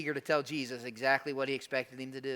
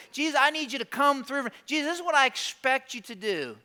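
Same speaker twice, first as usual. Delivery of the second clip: higher, pushy, entitled pleading to Jesus; demanding prayer; 7 semitones higher, 5 dB louder than usual. Acted. A churchgoer demanding a raise from Jesus, insistent. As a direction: list your demands like Jesus owes you. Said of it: "he approaches Jesus the way many times we approach Jesus".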